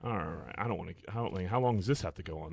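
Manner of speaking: soft, deflated mock resignation; acted weary reluctance. Voicing later reluctance after the novelty.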